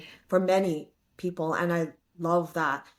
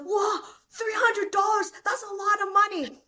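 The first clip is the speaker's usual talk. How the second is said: excited dad. Retold or imitated. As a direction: act out a giddy dad shouting with joy. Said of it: "He's like".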